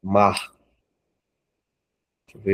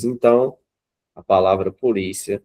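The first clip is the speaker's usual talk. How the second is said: usual speaking voice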